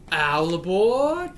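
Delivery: long drawn-out boarding call; playful train conductor calling out slowly; conductor's call. Pretend. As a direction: stretch it out like "all aboard". Punchline mimics a conductor's boarding call.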